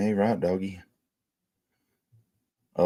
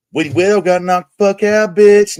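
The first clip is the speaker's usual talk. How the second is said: gloating taunt; 7 semitones higher, 6 dB louder than usual. Real, not acted. Taunting Mandela over the knockout.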